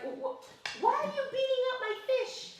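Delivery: put-on high, laughing child's cry; stammering boy. Eight-year-old son seeing his fish beaten, high stammering cry. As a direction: stammer like a shocked kid. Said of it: "He's like".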